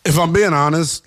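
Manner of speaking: ordinary delivery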